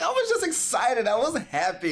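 excited high voice. Retold or imitated. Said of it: "I was like".

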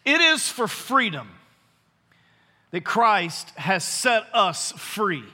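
slow, deliberate scripture reading; unhurried Bible reading for the congregation; read nice and slow. Shown as a demonstration. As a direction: let each word land slowly. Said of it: "I'm gonna read it nice and slow".

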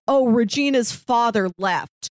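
ordinary talk

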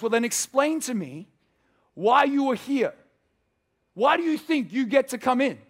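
high, loud exasperated demand; the supervisor angel loses patience with the thief, loud and high. Retold or imitated. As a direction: press the thief hard for an answer, voice up high.

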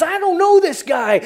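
loud, high-pitched acted protest; emphatic denial. Preacher as Peter denying Jesus a second time, loudly protesting.

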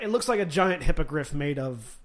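ordinary delivery

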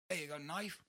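lightly Australian, high and soft; quoting the drunk Aussie, a hint of accent. Drunk Australian on the dock asks for a knife, soft mock accent.

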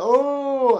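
the crowd, suddenly hooked and alarmed; shocked gasp. Retold or imitated. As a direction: gasp as if hearing terrible news. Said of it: "Everyone's like".

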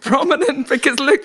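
breathless fangirl squeal with a laugh; gushing excitement. Retold or imitated. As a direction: rave like a wide-eyed newcomer. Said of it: "'Cause I was like".

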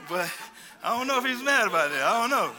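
can't stop chuckling at his own joke; laughing. Real, not acted. Preacher laughing with the congregation at his joke.

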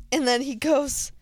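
normal talking voice

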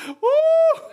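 high, loud joyful woo; joyful whoop. Real, not acted. Preacher whooping with joy at his dream, loud and high. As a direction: let out a happy shout of joy.

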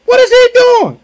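high, loud frightened cry; panicked disciples. Retold or imitated. Disciples on the boat crying out at a ghost, high and loud. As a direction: shriek it like scared men in a boat.

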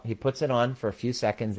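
normal talking voice

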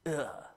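short soft grunt of revulsion; disgusted groan. Shown as a demonstration. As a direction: wrinkle your nose and groan. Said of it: "wrinkled nose, ticked off".

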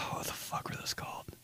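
sighed whisper; breathy whispered question. Real, not acted. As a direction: mutter it under your breath, voiceless. Whispered to himself.

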